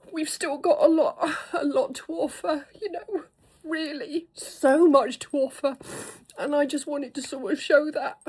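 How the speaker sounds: holding back real tears while thanking viewers; emotional, half-crying gratitude; tearful. Real, not acted. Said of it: "I'm not gonna start crying".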